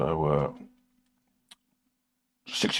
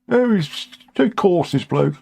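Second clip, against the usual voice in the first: higher, prissy disapproving voice; mock prim critic; 8 semitones higher than usual. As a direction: act out a pearl-clutching viewer. Voicing critics.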